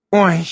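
loud dismissive snort of a word; a loud scornful oy. A narrator's reading. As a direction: scoff it off loudly. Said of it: "фыркнула Ирен".

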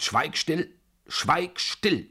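sharp interruption. A narrator's reading. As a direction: cut them off, pitch leaping up. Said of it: "unterbrach sie mich". (herrisch ins Wort fallend)

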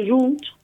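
junts said in Catalan, a y-like start and final ts; Catalan pronunciation. Shown as a demonstration. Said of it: "estoy hablando catalán". (junts pronunciado en catalán)

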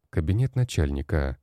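plain reading voice

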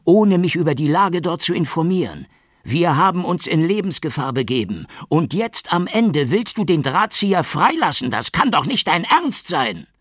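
very high, loud outraged shouting; furious shout. A narrator's reading. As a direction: let the anger explode at the boss. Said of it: "vor Zorn bebend".

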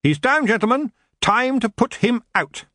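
high-pitched rallying call; shouting. A narrator's reading. Geoffrey calls the gentlemen to finish the elf, shouting. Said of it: "he shouted".

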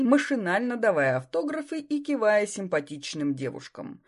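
usual speaking voice

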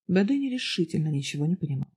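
ordinary delivery

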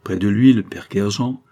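ordinary delivery, nothing marked

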